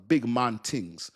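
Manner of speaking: slow, stretched Jamaican imitation; Jamaican accent. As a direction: lay it out slowly with a Jamaican accent. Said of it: "when Jamaicans say".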